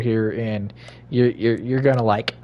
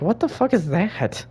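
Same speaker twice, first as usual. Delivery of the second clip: startled; 9 semitones higher than usual. Retold or imitated. Retold startled reaction.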